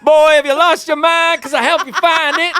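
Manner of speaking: high, loud indignant rant; Stanley from The Office. Acted. Comedian mimics a sitcom character, high exasperated impression. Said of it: "feel like Stanley from The Office".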